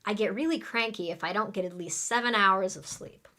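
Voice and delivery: rattled off quickly, words run together; quick native-speed delivery; really fast. Shown as a demonstration. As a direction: speed through the whole sentence. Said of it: "Let's say it really fast".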